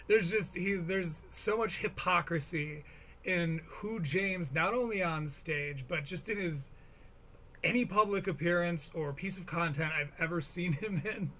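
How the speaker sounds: ordinary talk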